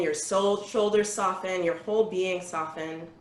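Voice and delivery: calm, unhurried guiding voice with long pauses; guided meditation. Acted. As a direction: lead the group gently through their breathing. Leads a breathing meditation.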